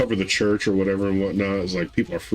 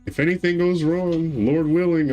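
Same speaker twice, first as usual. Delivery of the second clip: much higher mock guilt-tripping mother; mom's voice; 9 semitones higher than usual. Mother guilt-tripping her son, high mock voice. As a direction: lay on the guilt sweetly, pitched high.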